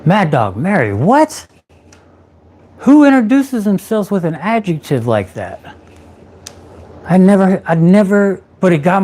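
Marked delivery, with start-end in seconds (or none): 0.1-5.8 disbelieving echo of her odd name; incredulous. Real, not acted. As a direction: pitch jumping up on what in disbelief. Incredulous repeat.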